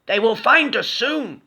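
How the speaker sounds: high, slow, worried skeleton. A narrator's reading. Skeleton fearing capture, high slow worry. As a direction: fret slowly as the skeleton. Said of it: "said Skeleton".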